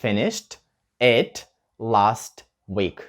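very slow, drawn-out model sentence. Shown as a demonstration. Teacher modelling the full sentence slowly for learners. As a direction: stretch each word for the class. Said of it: "звучить воно як T в кінці".

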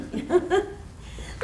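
gushing high hostess laugh; high trilling laugh. Acted.

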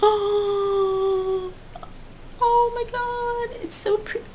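high, slow swooning delight. Real, not acted. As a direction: swoon over something pretty. Swooning over the corset.

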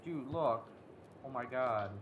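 ordinary delivery

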